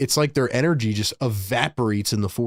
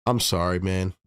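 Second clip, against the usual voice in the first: sick voice; 6 semitones lower, 4 dB quieter than usual. Real, not acted. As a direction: voice worn down by a cold. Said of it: "I'm a little under the weather".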